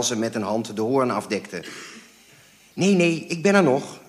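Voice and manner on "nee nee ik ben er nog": high, loud, hurried-to-reassure phone talk; flustered reassurance. A narrator's reading. Mother assuring caller she is there, flustered. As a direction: keep the caller on the line, flustered.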